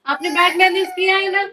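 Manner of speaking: mother scolding her daughter for pushing her brother. Real, not acted. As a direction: tell her off firmly.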